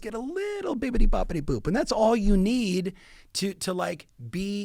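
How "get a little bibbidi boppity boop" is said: a hint of a playful Italian sing-song, high; lightly hammed-up Italian lilt; mock Italian lilt. Coach showing how he mirrors Italians, light Italian lilt. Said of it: "like, when I'm in Italy".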